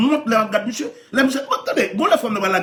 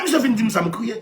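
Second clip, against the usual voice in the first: normal talking voice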